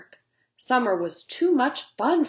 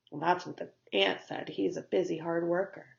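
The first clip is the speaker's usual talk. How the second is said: usual speaking voice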